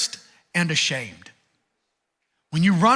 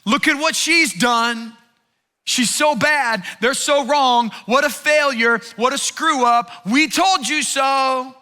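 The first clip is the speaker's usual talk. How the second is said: loud, high, jeering accusations; a mocking enemy yelling blame; accusing enemy scream; 5 semitones higher, 4 dB louder, slower than usual. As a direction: shout them down as the accuser. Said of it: "is now screaming".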